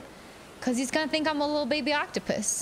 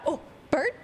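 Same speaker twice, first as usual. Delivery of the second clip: ordinary talk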